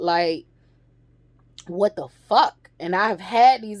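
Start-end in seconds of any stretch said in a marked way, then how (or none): none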